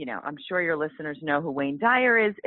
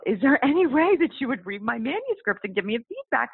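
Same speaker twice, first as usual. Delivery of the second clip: nervous rush, pitched up, words tumbling out; blurted out; 7 semitones higher than usual. Retold or imitated. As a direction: blurt it to your hero, a touch breathless. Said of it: "I just blurted out".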